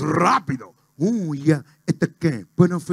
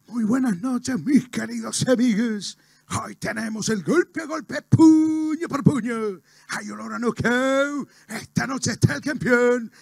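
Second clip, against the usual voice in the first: boxing announcer, a bit higher and lilting; Príncipe; 3 semitones higher than usual. Acted. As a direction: present the fight night, stretching the catchphrases. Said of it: "A la noche estaba Space, Príncipe, decía". (relator de boxeo, tono más agudo y cantado)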